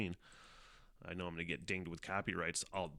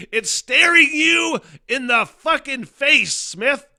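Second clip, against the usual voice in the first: self-mocking yell; 17 dB louder, slower than usual. Real, not acted. Mocking himself over the note.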